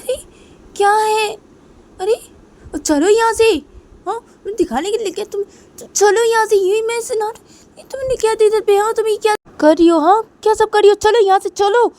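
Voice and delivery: very high, very loud tirade; furious shouting. Acted. Piu throwing Rui out of the room, furious shouting. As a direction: yell at her to get out at once.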